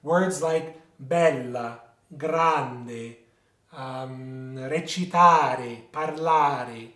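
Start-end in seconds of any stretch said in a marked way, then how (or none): 1.0-3.2 bella, grande stressed on BEL and GRAN; penultimate stress. Shown as a demonstration. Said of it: "emphasis or stress on the second last syllable". 4.6-6.9 recitare, parlare slowly stressed on TA and LA; penultimate stress. Shown as a demonstration. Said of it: "emphasis or stress on the second last syllable".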